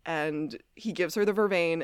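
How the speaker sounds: ordinary talk, nothing marked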